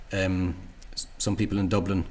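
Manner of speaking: normal talking voice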